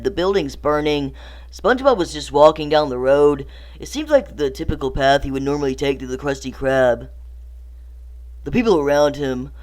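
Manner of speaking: ordinary delivery, nothing marked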